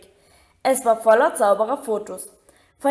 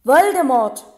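name bellowed, much louder; shouted; 3 semitones higher, 7 dB louder than usual. A narrator's reading. Harry shouting the name at Hagrid to stop his crying. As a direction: bark the name out to shock him. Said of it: "brüllte Harry".

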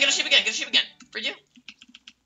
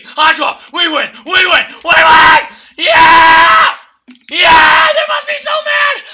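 very loud, high victory screams; real triumphant yell; victory screaming; 12 semitones higher, 8 dB louder, slower than usual. Real, not acted. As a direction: scream with joy at the win. Celebrating the win.

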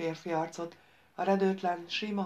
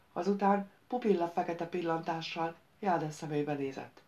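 plain reading voice

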